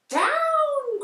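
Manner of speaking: high, loud cry of down; cried out. A narrator's reading. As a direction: call the broom down fast. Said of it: "cried the witch".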